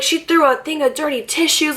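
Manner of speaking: high, overwrought mock outrage; dramatic playing-up. Mom telling security the played-up story, hammed-up outrage. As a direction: pitch it up like an exaggerating accuser. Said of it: "we're dramatic".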